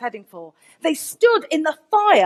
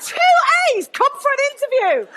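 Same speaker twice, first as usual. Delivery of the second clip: much higher, excited mock voice; delighted; 11 semitones higher, 4 dB louder than usual. College man offering an applicant an interview, delighted. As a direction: jump up high, thrilled and eager.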